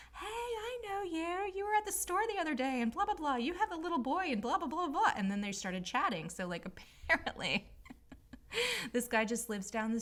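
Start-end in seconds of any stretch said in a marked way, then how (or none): 0.1-5.1 mocking chatty neighbor. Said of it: "she's like". 7.7-8.9 high laugh. Real, not acted. Laugh while telling story.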